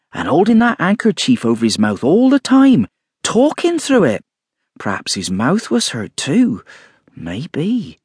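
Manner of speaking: high, wondering gossip voice; chattering landlady. A narrator's reading. Mrs Hall wondering aloud.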